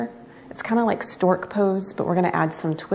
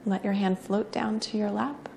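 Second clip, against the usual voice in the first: soft, unhurried yoga-teacher cues; guided breathing meditation; 10 dB quieter than usual. Real, not acted. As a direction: guide each breath quietly, with long pauses between cues.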